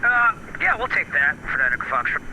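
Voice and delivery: radio-filtered pilot voice; like through an aviation headset; over a pilot headset. Real, not acted. Pilot reading back over the radio.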